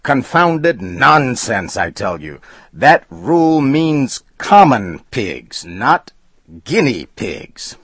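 high, loud and slow, biting each word; angry protest. A narrator's reading. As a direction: insist furiously. Furious customer arguing.

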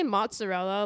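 "mozzarella" with a loud, hammed-up Italian accent; Italian-style mozzarella. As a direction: imitate an Italian TV cook on "mozzarella". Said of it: "T V cook that says it like that".

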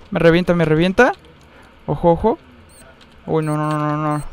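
usual speaking voice

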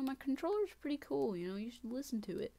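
normal talking voice

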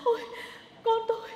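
gasping cries building to a scream; anguished wailing. Acted. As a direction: wail for your dead child. Mother finds her son dead.

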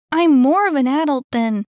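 loud, high, indignant comeback; bickering retort. Acted. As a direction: argue back until cut off. Bickering, cut off by door.

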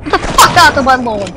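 loud chase-them-off shout; playful territorial yelling; mock-angry yell. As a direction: holler it like an irate homeowner, playfully. Yelling at enemy in game.

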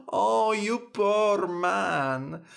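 high, slow drawn-out commiseration; mocking pity. Fairy laughing at the poor king, high mock sympathy. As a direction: pity him with relish.

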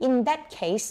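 "in that case" with a clear s ending on "case"; final consonant sounded. Shown as a demonstration. Teacher has students repeat after her, final consonant sounded. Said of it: "ข้างหลังมีนะ".

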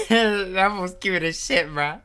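gushing through a laugh. Real, not acted. Reacting to cute reindeer.

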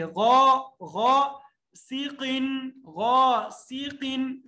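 slow غا سیقن with a thick غ; heavy ghain. Shown as a demonstration. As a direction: draw out غا heavily. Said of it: "موٹا پڑھیں گے".

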